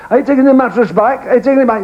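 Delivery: calling out across the street; shouting across the road. Retold or imitated. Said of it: "she shouted across the road".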